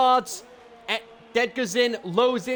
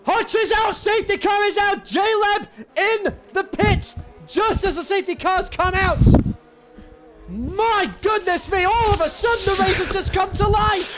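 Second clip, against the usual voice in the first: excited commentator; 8 semitones higher than usual. Real, not acted. Safety car called out.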